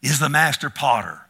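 loud, emphatic preaching; forceful preaching. Real, not acted. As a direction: hammer home that God makes no mistakes.

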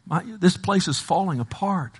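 high-pitched lament to God; crying out to God. Retold or imitated. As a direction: plead as the despairing prophet. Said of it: "he's crying out".

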